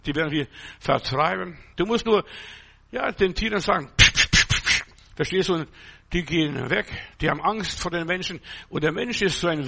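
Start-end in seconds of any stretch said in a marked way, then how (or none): 4.0-4.8 shooing sound. Shown as a demonstration. Shooing animals away, acted.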